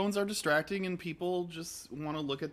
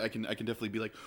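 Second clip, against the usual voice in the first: ordinary talk, nothing marked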